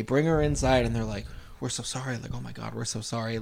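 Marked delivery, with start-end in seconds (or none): 1.6-3.4 soft apology. Retold or imitated. Kids apologize to stepmother.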